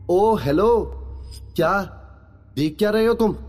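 put-on high, challenging girl's voice; annoyed girl. A narrator's reading. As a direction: confront a starer in a high voice. Girl scolds him for staring.

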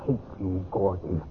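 soft, slow, bitter words of hate; bitter hatred. Acted. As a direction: let the hatred seethe quietly. Bitter quarrel with her husband.

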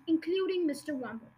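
ordinary delivery, nothing marked

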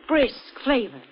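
ordinary talk

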